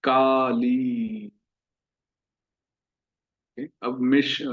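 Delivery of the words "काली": काली slowly, aa and ee held; long काली. Shown as a demonstration. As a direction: stretch both long vowels in काली. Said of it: "आप सब मेरे पीछे पीछे बोलिए".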